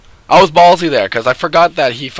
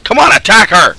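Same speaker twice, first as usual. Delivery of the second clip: loud high urging shout at the ally; urging yell; 8 semitones higher, 8 dB louder than usual. Real, not acted. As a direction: push the teammate to attack, loudly. Yelling at ally.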